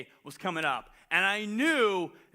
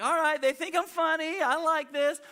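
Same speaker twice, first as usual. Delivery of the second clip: high, chuffed inner voice; a boy who thinks he's winning; pleased excitement; 8 semitones higher than usual. Retold or imitated. Retold hopeful inner thought.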